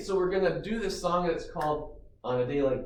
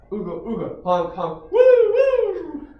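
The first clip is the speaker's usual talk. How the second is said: goofy honks ending in high loud woos; silly clown; 13 semitones higher, 9 dB louder than usual. Shown as a demonstration. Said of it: "fun song motions and sounds".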